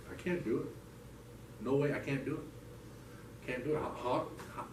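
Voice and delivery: soft, halting, breath-heavy despair; re-enacted tearful giving up; tearful, overwhelmed. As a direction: plead helplessly, slowly and quietly. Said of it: "I'm in full-blown tears".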